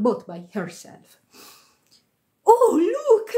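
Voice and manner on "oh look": put-on high, loud boyish cry; excited cry. A narrator's reading. Second boy spots something startling, excited high cry. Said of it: "cried the second boy".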